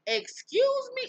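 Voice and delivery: high, drawn-out disbelief; scandalized exclamation, slow and high-pitched; incredulous. Real, not acted. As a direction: stretch it up high, appalled. Reacting to mother's outrageous claim.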